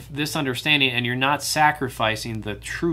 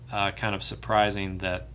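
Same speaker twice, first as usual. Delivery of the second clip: normal talking voice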